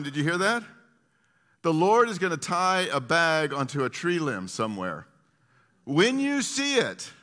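loud, high, emphatic sermon; preaching. Real, not acted. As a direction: drive it home to the congregation at full voice. Preaches at full force on prophecy.

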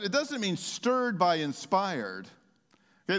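normal talking voice

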